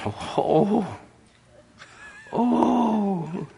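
hammed-up high wail; mock groaning. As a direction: imitate a woman groaning in a trance. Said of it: "She's going".

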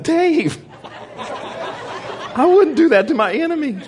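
high, slow, laughing protest; laughing plea. Retold or imitated. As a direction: beg him through laughter.

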